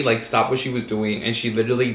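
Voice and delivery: normal talking voice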